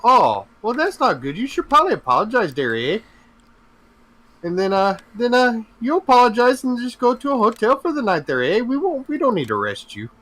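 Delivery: high, gentle Canadian cop voice; Canadian cop letting the suspect off with an apology; mock Canadian cop. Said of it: "Canadians' version of Cops".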